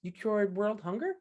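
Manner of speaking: high-pitched, curious interviewer reading a résumé; high, drawn-out impressed question. Acted. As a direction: ask with amazed interest, rising at the end. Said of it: "and they'll say".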